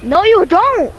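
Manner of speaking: high, loud teasing reply; loud retort. Pretend. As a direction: shout back playfully.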